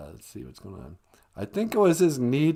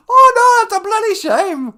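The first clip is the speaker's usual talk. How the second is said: loud, high Irish-tinged lament; dismayed falsetto with muddled Irish accent; Irish-slipping Queen; 13 dB louder than usual. Acted. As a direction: cry out in a botched Irish falsetto. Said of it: "slides into sort of an Irish accent".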